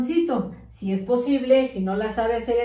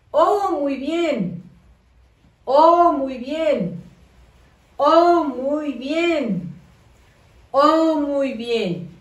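slow, stretched encouraging praise; teacher praising little kids, slow and sweet; drawn-out praise; 3 semitones higher, 4 dB louder, much slower than usual. Acted. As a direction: praise each child, drawing out every word. Praising children's answers.

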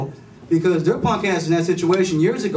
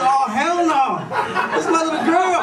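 much higher, outraged squeal; shocked dad; 12 semitones higher, 4 dB louder than usual. Retold or imitated. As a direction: squeak it out in disbelief. Dad shocked at daughter's swearing.

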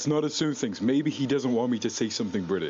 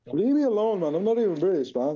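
exasperated protest; 6 semitones higher than usual. Real, not acted. Protesting being teased.

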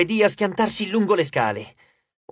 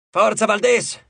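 loud, urgent call across the deck; shouted command; shouting; 5 semitones higher, 3 dB louder than usual. A narrator's reading. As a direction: bark the order over the noise. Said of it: "gridò Hedge".

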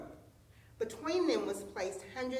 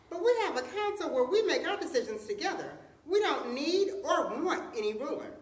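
higher-pitched little mouse voice; little mouse speaking up; 6 semitones higher, 4 dB louder than usual. A narrator's reading. Little mouse objects to the tigers' rule, speaking up in a higher voice. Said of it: "A little mouse spoke up from the crowd".

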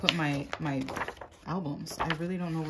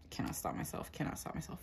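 whispered aside; 10 dB quieter, faster than usual. Real, not acted. Whispering to herself.

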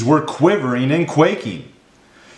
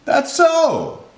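high exclamation falling away; acted elephant astonishment; crying out, amazed. A narrator's reading. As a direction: swoop up high in realization. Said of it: "cried the elephant".

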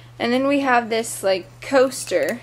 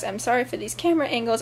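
normal talking voice

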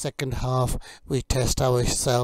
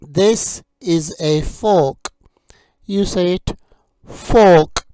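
slow, loud, carefully clear teacher drill; vocabulary drill; 4 semitones higher, 5 dB louder, slower than usual. Acted. Teacher drilling kitchen words for learners, slow and clear. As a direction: model each word for learners to repeat.

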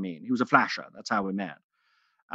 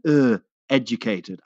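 dim mocking lout; 3 dB louder, slower than usual. Bully mocks reading girl.

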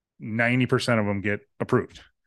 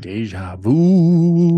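spooky voice; 6 semitones higher, 8 dB louder, much slower than usual. Pretend. Spooky echo at tavern opening.

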